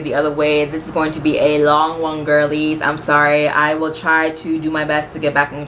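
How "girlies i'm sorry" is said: usual speaking voice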